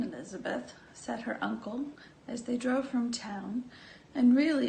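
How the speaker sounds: plain reading voice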